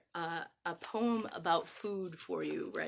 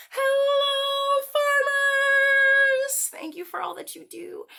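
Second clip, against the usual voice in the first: much higher and louder, long drawn hello; cheerful shout; 14 dB louder, slower than usual. Shown as a demonstration. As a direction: call out to the farmers. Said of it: "One, two, three".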